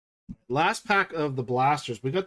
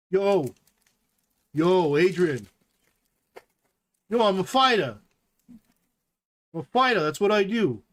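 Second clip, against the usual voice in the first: Rocky impression. Riffing on a Rocky card for viewers, light Rocky voice. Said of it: "So Rocky didn't cuss".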